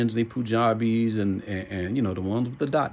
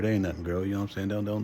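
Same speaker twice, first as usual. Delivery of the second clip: ordinary delivery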